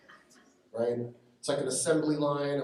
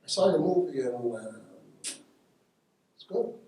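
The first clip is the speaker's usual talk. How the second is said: a hint of De Niro, trailing off into a breathy mumble; lightly voiced De Niro giving a grudging compliment; De Niro impression; slower than usual. Comic teller doing De Niro's terse praise, lightly voiced. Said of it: "he did the whole, you know".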